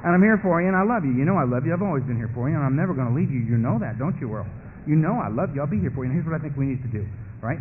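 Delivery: low-pitched, smooth reassurance; coaxing beast. Acted. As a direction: pledge devotion to lure him in. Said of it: "the beast whisper up and say".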